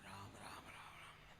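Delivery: low whispered chant fading out; hushed mourning. Retold or imitated. Mourner at the cremation murmuring the god's name, whispered.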